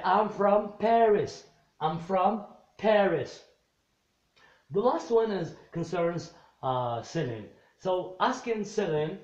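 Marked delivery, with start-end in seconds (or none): 0.0-3.3 slow, loud "I'm from Paris"; teacher voicing Jack's answer to the class; emphatic model answer. Shown as a demonstration. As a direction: project "I'm from Paris" so the back row hears it. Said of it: "teacher modelling Jack's answer".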